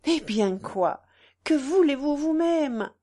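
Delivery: rising, agitated challenge; exalted. A narrator's reading. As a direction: demand it with mild exaltation. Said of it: "continua-t-elle avec exaltation". (interpellation exaltée)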